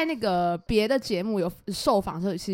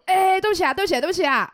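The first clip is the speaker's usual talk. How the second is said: pleading apology; 8 semitones higher, 5 dB louder, faster than usual. Retold or imitated. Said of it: "可强就挡在走廊说".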